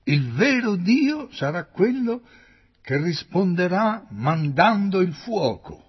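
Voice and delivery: triumphant prophet's cry; ringing proclamation. A narrator's reading. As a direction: proclaim it to the whole crowd.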